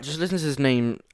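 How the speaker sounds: usual speaking voice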